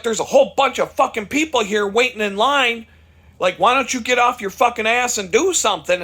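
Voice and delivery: retold rant, loud and demanding; imitating pissed-off customer. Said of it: "this dude was pissed".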